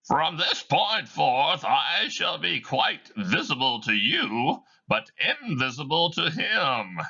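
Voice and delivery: a ghost talking to the audience; high, slow ghostly narrator; Marley's ghost. Acted. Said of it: "Marley should fade into view".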